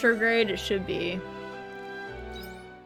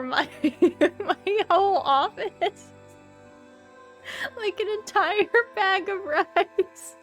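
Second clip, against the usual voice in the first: laughing disbelief; 8 semitones higher, slower than usual. Real, not acted. Laughing disbelief story climax.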